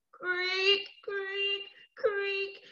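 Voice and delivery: high, stretched creaking noise; strange rope creak. Acted. Storyteller voicing the old swing rope, high creaks. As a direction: imitate an old rope straining, pitched high. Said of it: "began to make a very strange noise".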